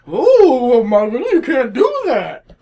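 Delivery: high, loud scolding voice; prissy mock scold, hammed up. Mocking critics scolding a writer, loud prissy voice. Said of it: "it's like".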